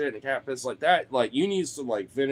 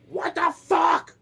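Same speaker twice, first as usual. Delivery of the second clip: slow, high, loud outraged scream; mock-furious high shriek at the cat; freaking out; 5 dB louder, much slower than usual. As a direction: draw it out in a high re-enacted yell. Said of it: "freaking the fuck out".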